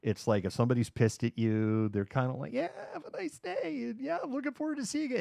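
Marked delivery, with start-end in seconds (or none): 2.5-5.2 very high, soft mock-sweet falsetto; passive-aggressive. Seattleite pissed at you but being nice, mock passive-aggressive. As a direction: fake niceness, sing it out high. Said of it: "it's a passive aggressive city".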